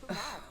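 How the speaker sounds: soft, breath-heavy ugh; acted groan of exasperation; exasperated sigh. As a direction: let out a quiet fed-up sigh. Zara exasperated at Lorelai's advances.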